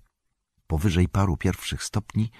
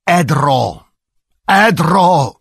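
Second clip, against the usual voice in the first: booming, rising in anger, much higher and louder; commanding angry shout; 10 semitones higher, 10 dB louder, much slower than usual. A narrator's reading. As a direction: command the rock with growing fury. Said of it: "tonem władczym z rosnącym gniewem krzyknął".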